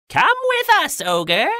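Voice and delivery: very high, loud shouted order; witches' shout. A narrator's reading. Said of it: "they shouted".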